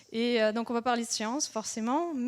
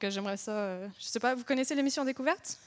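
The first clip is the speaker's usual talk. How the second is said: ordinary talk, nothing marked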